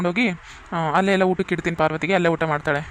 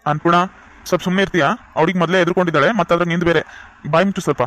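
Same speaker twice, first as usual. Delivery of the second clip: angry scolding; 5 dB louder, faster than usual. Acted. Brother scolds nagging sister.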